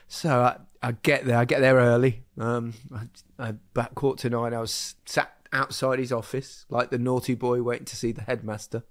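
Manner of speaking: normal talking voice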